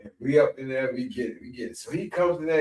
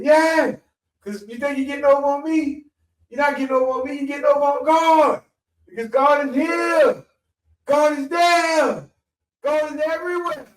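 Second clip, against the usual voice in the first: preaching shout; 14 semitones higher, 5 dB louder than usual. Retold or imitated. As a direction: build the sermon to a roar. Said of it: "he said".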